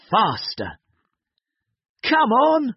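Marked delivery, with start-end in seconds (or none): 2.0-2.7 panting. Acted. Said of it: "panted Dad".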